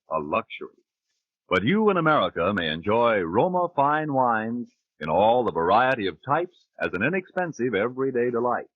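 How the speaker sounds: ordinary delivery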